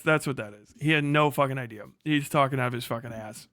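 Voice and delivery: ordinary talk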